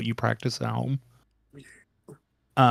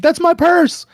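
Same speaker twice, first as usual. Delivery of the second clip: shrill high scream, much higher and louder; screaming woman; 7 dB louder than usual. Acted. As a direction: shriek it like a robbed woman. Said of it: "And she's like".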